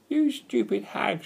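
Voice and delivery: high, slow screech of insult; furious witch's high-pitched scolding; angry shriek. A narrator's reading. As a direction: shriek it up at your enemy, drawn out. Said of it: "she shrieked up at the gull".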